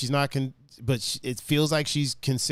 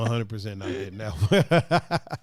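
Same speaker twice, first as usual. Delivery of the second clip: laughing; 4 semitones lower than usual. Real, not acted. Mocking [name] album.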